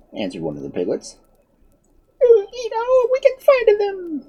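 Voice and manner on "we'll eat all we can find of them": very high, squeaky piglet. A narrator's reading. Second piglet eager for more fruit, squeaky and high. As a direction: pipe up like a tiny pig.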